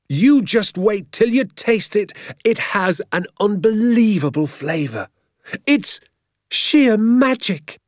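rapturous dad dreaming of the roast; enthusiastic father. A narrator's reading. Father promising Danny a feast, rapturous and slow.

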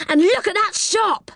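high exclamation of amazement; excited cry. A narrator's reading. Rick spotting a shop made of sweets, excited cry. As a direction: point it out in a high, thrilled voice. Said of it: "cried Rick".

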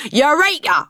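loud, high "eureka" moment; triumphant cry. Acted. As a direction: cry out your brilliant discovery. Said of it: "I cried".

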